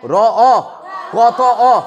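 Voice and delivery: র, অ, ক, ত, অ sounded out one by one, loud and separate; loud teacher sound-by-sound drill; phoneme by phoneme. Shown as a demonstration. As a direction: spell out each sound of রক্ত. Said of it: "রক্ত শব্দে কটা ধ্বনি?".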